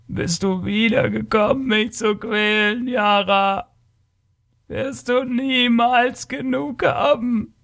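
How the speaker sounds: high, drawn-out wailing creature voice; slow, high lament of a suffering being; tormented alien creature. A narrator's reading. Blind creature asks its tormentor why suffering never ends, anguished.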